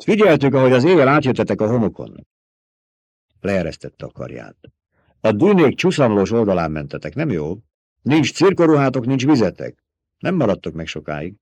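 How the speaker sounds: scornful fighter rejecting a bribe; proud Fremen warrior. A narrator's reading. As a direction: Fremen warrior scoffing at being bought.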